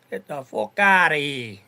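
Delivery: loud, drawn-out mock Irish accent; Brooklyn's Irish accent. Repeating the dismissal as Brooklyn would, Irish accent. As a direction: belt it out slowly in an imitated Irish accent. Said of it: "as Brooklyn would say in his Irish accent".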